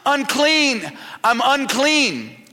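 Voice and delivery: high, loud, drawn-out cry of unclean; yelled warning. Retold or imitated. Outcast woman warning the townspeople away, loud drawn-out cry. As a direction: call it out to the whole town like an outcast. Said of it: "you had to yell these words".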